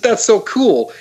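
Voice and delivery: high, slow, wide-eyed that's so cool; a kid thrilled by something new; delighted awe. Retold or imitated. Alien amazed after seeing him snort tea. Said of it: "He said".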